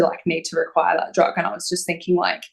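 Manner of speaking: ordinary talk, nothing marked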